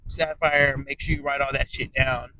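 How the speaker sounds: ordinary talk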